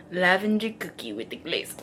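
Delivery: lightly voiced, breathy panting describer; mock panting food talk. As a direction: ham up his breathless dessert talk. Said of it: "he's, like, panting".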